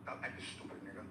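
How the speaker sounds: hushed, urgent whisper. Real, not acted. Telling his friend to stop acting stupid, whispered. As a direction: hiss it low.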